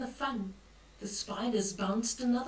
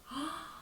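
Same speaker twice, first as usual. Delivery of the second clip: soft, higher pretend gasp; mock gasp; 5 semitones higher, 9 dB quieter than usual. Granny pretending shock at a monster picture, soft gasp. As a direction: gasp for the children's benefit.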